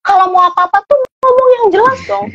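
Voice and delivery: high-pitched nagging parent voice; mock fed-up parent snapping at a child; exasperated parent. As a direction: scold a toddler in a high, fed-up voice. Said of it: "kalau kita bilang".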